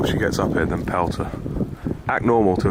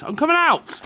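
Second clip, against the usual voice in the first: calling out. Real, not acted. Calling out from inside.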